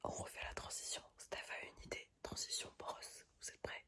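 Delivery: soft whispered goodnight; whispering. Acted. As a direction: hush it like a bedtime sign-off. Whispered redo of the transition.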